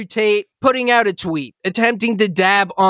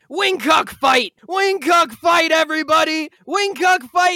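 hype shout; 6 semitones higher than usual. Pretend. Host hypes the feud to his listeners, announcer shout. Hyping an online fight announcement.

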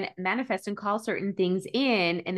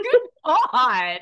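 high, loud half-crying groan; mock anguish; 5 semitones higher, 7 dB louder than usual. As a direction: wail it in comic despair. Dreading moving twice.

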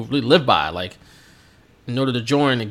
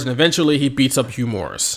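normal talking voice

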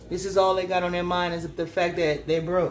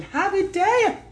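much higher, startled; surprised; 11 semitones higher, 4 dB louder, slower than usual. Real, not acted. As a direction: blurt it out in shock. Shocked at card.

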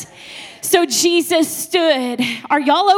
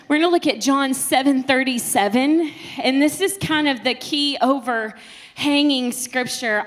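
usual speaking voice

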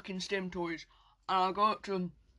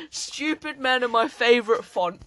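usual speaking voice

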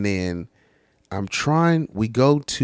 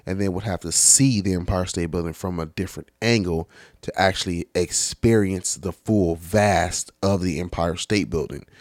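ordinary talk

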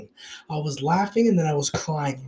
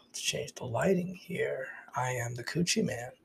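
whisper; 2 semitones lower, 11 dB quieter than usual. Real, not acted. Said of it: "aside while adjusting".

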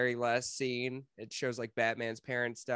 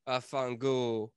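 put-on Italian accent; much slower than usual. Mock Italian curse.

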